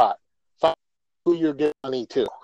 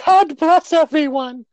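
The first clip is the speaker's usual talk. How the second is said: high, slow blessing voice; grateful beggar; 5 dB louder, slower than usual. Pretend. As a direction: bless the crowd slowly, up high.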